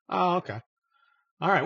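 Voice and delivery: usual speaking voice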